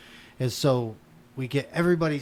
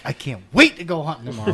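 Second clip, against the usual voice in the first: higher, louder eager outburst; excited retelling; 4 semitones higher, 5 dB louder, faster than usual. Retold or imitated. Hunter to companion on the drive home, excited. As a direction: gush with anticipation. Retold eagerness after a hunt.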